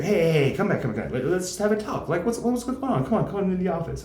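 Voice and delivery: high, pleading counselor calling her back; mock counselor voice. Counselor begging the comedian to come back, in a high mock-sweet voice. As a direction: coax her back like a fake-nice counselor.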